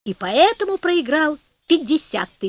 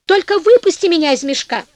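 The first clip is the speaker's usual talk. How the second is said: high, loud desperate plea; crying out; 5 semitones higher, 6 dB louder than usual. A narrator's reading. Devil trapped in the sack pleading for release, crying out. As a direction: shout to be freed, urgent and high. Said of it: "закричал чёрт".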